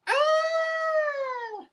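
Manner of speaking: long, very high "Ah!"; mock squeal of dismay, held long; retold cry of dismay. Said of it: "I was going".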